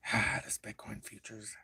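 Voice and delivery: whispered aside to himself. Real, not acted. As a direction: breathe it out to yourself. Muttering to himself at chart.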